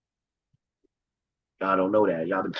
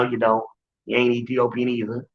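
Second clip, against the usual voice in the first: normal talking voice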